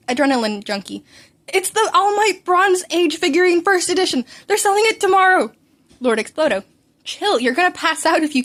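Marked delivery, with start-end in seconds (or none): 1.5-5.5 high, loud, breathless fanboy gushing; eager high squeal of a superfan; excited fanboy. Acted. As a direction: gush like an overeager kid about a figurine. Excited fan voice of Midoriya.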